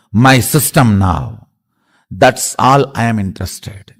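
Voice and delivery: normal talking voice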